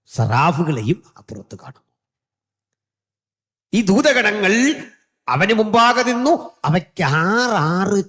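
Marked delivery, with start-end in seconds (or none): 3.7-8.1 high, loud, sustained pulpit cry; soaring revival preaching; preaching at full force. Acted. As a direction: proclaim it like scripture to a crowd.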